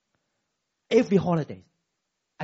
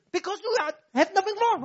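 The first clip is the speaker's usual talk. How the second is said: angry wife; 12 semitones higher, 4 dB louder, faster than usual. Retold or imitated. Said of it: "wife answers husband".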